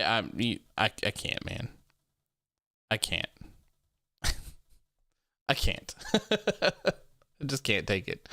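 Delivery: quiet, breathy laughing despair; exasperated laughter. Real, not acted. Streamer unable to bear Apollo's accent, quiet exasperated laughter. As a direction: give up through a soft laugh.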